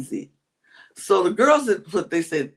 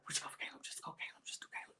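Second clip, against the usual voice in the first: whispering; 27 dB quieter, much faster than usual. Retold or imitated. Retelling whispered plotting.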